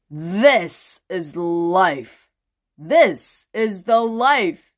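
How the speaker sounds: slow, smiling delight; a contented holiday-maker, grinning slowly. A narrator's reading. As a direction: savour it with a grin, slowly. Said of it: "he grinned".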